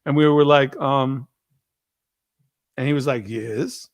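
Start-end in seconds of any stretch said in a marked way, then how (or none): 3.3-3.9 low, soft one-word answer; quiet, lower-pitched impression of the dad; imitating friend's dad. Dad answering the boys from the toilet, terse imitation. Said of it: "that's how he said it".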